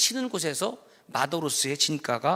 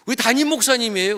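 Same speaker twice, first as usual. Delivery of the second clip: bright introduction; 5 semitones higher, 6 dB louder, faster than usual. Retold or imitated. Introducing the pastor to newcomers.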